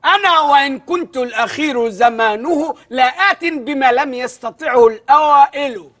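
slow, drawn-out poetic recital; measured classical reading; slow recitation. Shown as a demonstration. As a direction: recite the verse slowly for parsing. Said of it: "اعرب يا اخويا".

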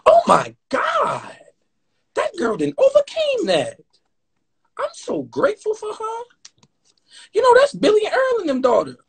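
put-on high, wailing old lady voice; old church lady. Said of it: "We gotta act like the old ladies".

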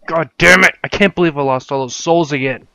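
a real furious yell; angry outburst. Real, not acted. Gamer shouting after losing souls again. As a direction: yell it in raw frustration.

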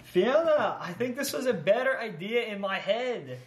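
high, loud failed Scottish accent attempt; a clumsy go at a Scottish accent; bad Scottish accent. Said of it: "That, that wasn't a Scottish accent".

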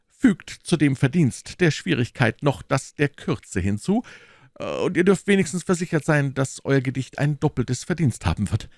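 high, animated mock praise; lively musketeer poking fun at a friend; teasing musketeer. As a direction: tease the poet with solemn compliments. Reader voices the mocking friend.

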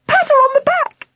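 urgent, very high-pitched order; panicked cry. A narrator's reading. Auntie Sally to Jim as Angela chokes, panicked cry. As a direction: cry it out in alarm. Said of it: "cried Auntie Sally".

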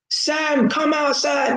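high, far-off call of a man outside a house at night. Retold or imitated. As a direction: call her name up to the window. Calling out to the neighbour at night.

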